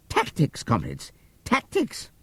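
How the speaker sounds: a sly pig chirping his clever word with glee; gleeful pig Squealer. A narrator's reading. Squealer crowing to the animals, high and gleeful. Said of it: "skipping round and whisking his tail with a merry laugh".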